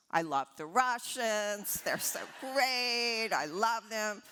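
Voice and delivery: a mock-delighted tycoon laying it on thick; gushing Donald Trump. Trump on the phone praising the Russians, mock-gushing.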